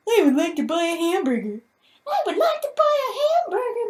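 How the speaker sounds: high put-on British voice; British accent. Shown as a demonstration. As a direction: squeak it in a posh British accent. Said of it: "in a British accent?".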